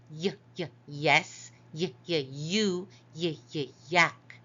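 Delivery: initial "y" sounded alone twice, then the word: "yes", "you", "yak"; short detached "y, y" before saying each of "yes", "you", "yak"; sounding out Y. Shown as a demonstration. Teacher showing the consonant Y, sounding out "y, y, yes". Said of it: "So we hear".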